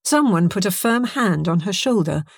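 usual speaking voice

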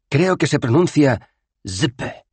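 slow, high, careful zp; pronounce zp. Acted. Rincewind trying to pronounce zp slowly. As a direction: sound out zp carefully.

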